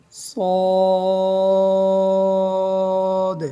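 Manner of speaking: long chanted সদ; drawn-out sad. Shown as a demonstration. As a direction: stretch সদ four alif lengths. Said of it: "চার আলিফ টান দিয়ে পড়তে হবে".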